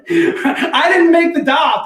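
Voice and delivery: very slow, cracking up through the words; denying to the coworker he made the doll, laughing; laughing protest. Real, not acted.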